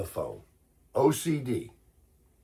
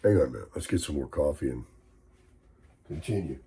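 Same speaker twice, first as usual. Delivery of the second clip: rough morning voice; 10 semitones lower, 7 dB quieter, faster than usual. Real, not acted. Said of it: "I can't stand talking like this".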